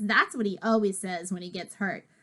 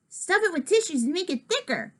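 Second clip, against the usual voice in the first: put-on higher, upbeat boy's voice; kid voice; 8 semitones higher, faster than usual. George advising on the fake cast, higher upbeat voice. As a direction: suggest it keenly, pitched up.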